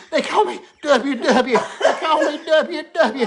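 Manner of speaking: high, loud, slow laughing strain; strained mock answer. Drunk man gives his name while being taken from behind, strained. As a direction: force the name out through the pain.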